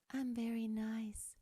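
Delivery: slow, soft whisper of sweetness; whispered niceness demo; very nice. Shown as a demonstration. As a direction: make it small and sweet. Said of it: "something is more up here".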